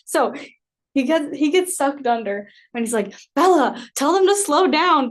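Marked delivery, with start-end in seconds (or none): 3.4-5.1 high, loud mock yell; mock scream. Cousin begging her to signal the boat, mock yell. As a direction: yell it in a panic. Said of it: "he's, like, screaming it".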